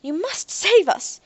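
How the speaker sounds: slow, high, loud plea; Doctor Avery shouts slowly and high at the hunters. A narrator's reading. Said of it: "he shouted at the hunters".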